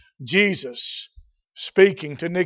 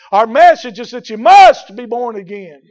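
"must" punched loudly; stress on MUST; 3 semitones higher, 11 dB louder than usual. Real, not acted. Preacher stating the true message to his church, loud stress on "must". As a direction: hit "must" hard against the rejected alternatives.

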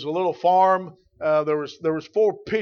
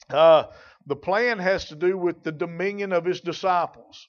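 ordinary talk, nothing marked

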